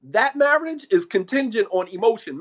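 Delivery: loud, hammered-out declaration; emphatic restatement. Real, not acted. Preacher judging an emotion-bound marriage, loud and emphatic. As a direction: drive the point home loudly.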